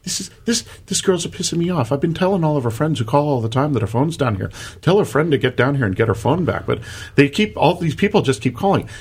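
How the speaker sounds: imitating the bartender in a high, light Irish accent; a quick Irish lilt, exasperated. Bartender complains about the phone in an Irish accent. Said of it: "trying to do the little Irish accent".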